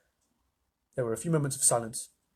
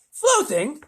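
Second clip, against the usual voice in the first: high, loud rising question; lisping President, astonished; incredulous; 14 semitones higher, 6 dB louder than usual. A narrator's reading. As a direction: echo it in disbelief.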